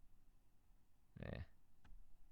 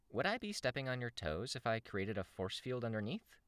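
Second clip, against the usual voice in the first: high-pitched character question; female character, asking; 8 semitones higher than usual. Acted. Crystal offering help to Tristan, high questioning voice. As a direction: lift the whole question well up.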